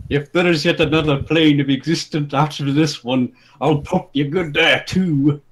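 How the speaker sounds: hint of a menacing tall brawler; taller brawler. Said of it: "his taller counterpart replied".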